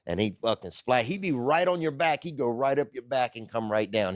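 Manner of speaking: ordinary talk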